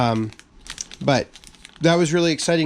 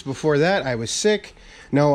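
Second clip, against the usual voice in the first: usual speaking voice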